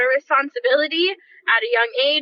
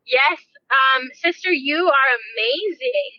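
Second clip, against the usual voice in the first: ordinary talk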